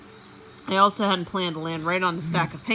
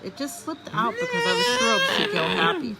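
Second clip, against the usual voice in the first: thrilled; 4 dB louder than usual. Acted. Hamster thrilled squeak.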